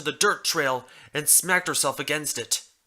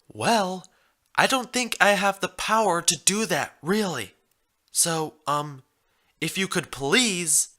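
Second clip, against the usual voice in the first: put-on hesitant young mare; 6 semitones higher than usual.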